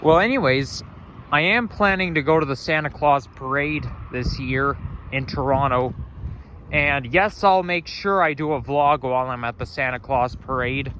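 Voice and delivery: normal talking voice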